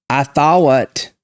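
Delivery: drawn-out Southern THOUGHT split in two, "thaw-wut"; slow Georgia drawl, "thought" stretched into a diphthong. Retold or imitated. Imitating Georgia callers on the phone, slow Southern drawl. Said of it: "many people would call on the phone and say".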